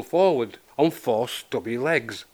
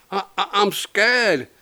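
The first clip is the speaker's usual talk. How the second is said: high, slow, stuttering fear; scared stammer; 7 semitones higher, slower than usual. A narrator's reading. Frightened Buster answering his friend, scared stammer. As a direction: falter on the first word in a high voice.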